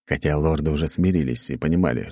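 normal talking voice

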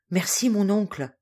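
ordinary delivery, nothing marked

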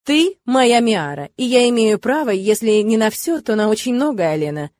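plain reading voice

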